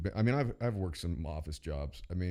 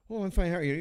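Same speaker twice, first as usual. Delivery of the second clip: much higher, mock polite customer; 11 semitones higher, 4 dB louder, faster than usual. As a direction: chirp a polite reply. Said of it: "And they'd say".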